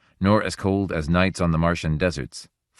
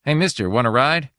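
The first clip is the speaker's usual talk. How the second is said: higher, louder friendly hail; calling out; 8 semitones higher, 5 dB louder than usual. A narrator's reading. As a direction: call over to a passer-by. Kid calls out from car.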